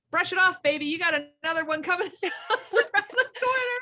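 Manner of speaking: high, loud, laughing encouragement; mock pep talk, laughing through it; high, loud, laughing cheer for the boy; cheering him on. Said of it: "all of us are like".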